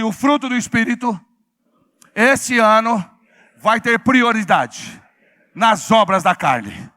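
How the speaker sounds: very loud declaration. Shown as a demonstration. Preacher giving congregation words to repeat, very loud declaration. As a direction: call it out for the crowd to repeat. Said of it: "fala bem alto assim".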